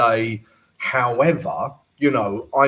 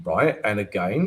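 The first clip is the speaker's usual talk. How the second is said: normal talking voice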